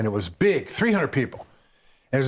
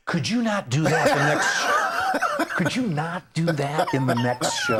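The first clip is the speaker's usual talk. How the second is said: higher, slower, mock-polite complaining woman through a laugh; 3 semitones higher than usual. Club owner asks comedian to stop the bit, mock-polite. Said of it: "She goes".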